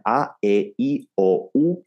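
A, E, I, O, U as pure, separate vowels; careful Spanish vowels. Shown as a demonstration. Coach showing learners accurate vowels, careful and distinct. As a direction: say each vowel cleanly, one by one. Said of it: "get your vowels as accurately as you can".

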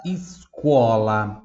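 escola drawn out slowly, each syllable clear; slow teacher model of escola; slow model word. Shown as a demonstration. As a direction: model escola at half speed. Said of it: "Em voz alta".

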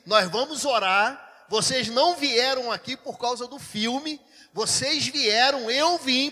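loud, emphatic pulpit address; full-force preaching. Retold or imitated. Pastor addressing a cinema audience, loud preacher's proclamation. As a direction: proclaim it to the whole room, stretching key words.

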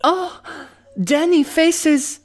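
hammed-up high Franco-Spanish melodrama; Spanish-French accent. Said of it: "This Spanish guy is in love with the French person".

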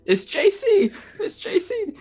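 squeaky high, laughing. Acted. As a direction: laugh while giving your name. Player answers in character.

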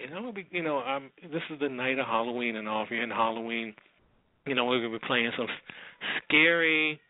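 ordinary talk, nothing marked

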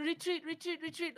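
high, frantic repeated retreat call; frantic retreat order. Retold or imitated. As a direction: squeal it like a panicked teammate. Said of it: "the only thing I hear is".

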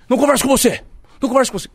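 high, loud mock snapping; imitated irate brush-off; retold angry refusal. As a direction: snap it back, re-enacting a furious coach. Aí ele sai puto.

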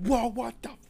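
loud, high yelp of shock; a sudden high cry at a stranger in the shower; startled shout. Acted. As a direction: jump back and yell in fright. Alien in her shower.

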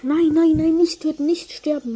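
loud, high panicked cry; panicked shout. Real, not acted. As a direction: yell in panic as you lose. Player about to die in game.

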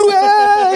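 high, loud burst of noise; revved-up yell. Retold or imitated. Bandmate mimics himself racing after the tempo, high whoop. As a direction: let out a high-pitched charging whoop. Acting out the revved-up chase on stage.

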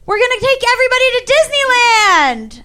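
high, hyped-up big reveal; excited announcement. Retold or imitated. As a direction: announce the grand prize to the staff. Retold boss announcing big surprise.

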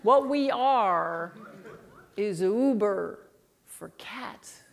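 very slow, condescending spelling-out; spoon-feed it, pausing between chunks; real slow. Retold or imitated. CEO explains to a lady he thinks stupid, real slow. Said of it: "I'm gonna say it real slow".

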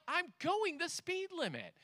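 lightly acted defensive retort; mock exasperated dad. Dad retorting to backseat kids.

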